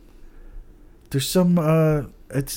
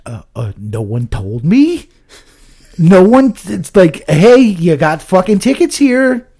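indignant protest; 5 semitones higher, 9 dB louder, slower than usual. Retold or imitated. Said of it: "I'm like".

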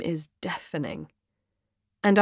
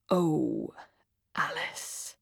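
soft sigh fading to a whisper; hushed dismay; 4 semitones lower, 9 dB quieter, much slower than usual. Acted. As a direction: let it sink into a pitying whisper. Awkward sympathy after the confession.

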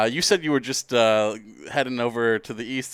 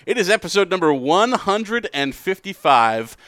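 big sing-song show opener, pitched up; podcast announcer intro; 4 semitones higher than usual. Acted. Host welcoming listeners to another episode, announcer style. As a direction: announce it with swooping, emphatic pitch.

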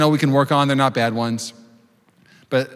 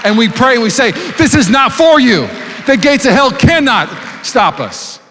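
fiery preaching; 5 semitones higher than usual. Acted. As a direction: preach it with full fire. Preaching at full force.

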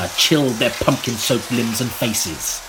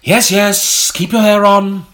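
exasperated; 8 semitones higher, 6 dB louder than usual. Real, not acted. Snaps back at rushing colleague.

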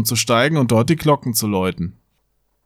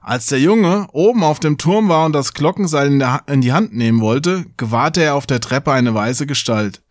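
ordinary delivery, nothing marked

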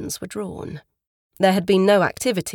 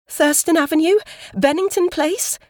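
high, loud, urgent questions; anxious guessing; 10 semitones higher, 6 dB louder than usual. A narrator's reading. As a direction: press for the address in alarm. Woman anxiously guesses the address.

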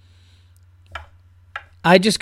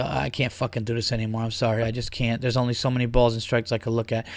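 weary defeated; 6 semitones lower, 10 dB quieter than usual. Pretend. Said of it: "one of the guys to go".

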